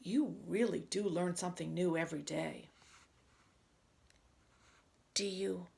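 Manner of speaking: expressive read-aloud with swooping pitch; animated picture-book dialogue. Acted. Reader voices two friends arguing over pretending, animated. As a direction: act out both characters for young children.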